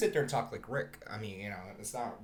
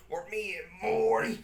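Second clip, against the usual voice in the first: higher put-on cartoon voice; drunken cartoon impression; 7 semitones higher, 3 dB louder than usual. Finishing the mock sauce order in drunken Rick voice.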